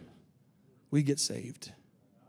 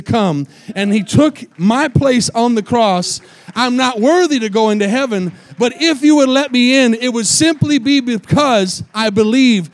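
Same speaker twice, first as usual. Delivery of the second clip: preaching at full force; 9 dB louder than usual. Real, not acted. Preacher telling the congregation why he will be let in, loudly.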